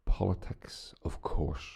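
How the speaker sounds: conspiratorial whisper on the topic; whispered tease. Acted. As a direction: breathe it out like a secret.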